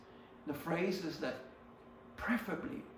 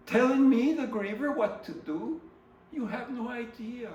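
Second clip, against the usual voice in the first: raised voice; 8 semitones higher, 8 dB louder than usual. Real, not acted. Griever protesting others' advice.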